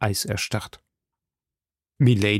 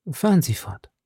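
a lightly voiced, secretive woman; Milady voiced. Milady answering softly.

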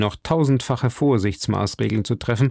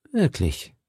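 softly doubtful, falling question; last doubt; 4 dB quieter than usual. A narrator's reading. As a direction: ask with a lingering doubt. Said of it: "Fragte Milady mit einem letzten Zweifel". (leise zweifelnd)

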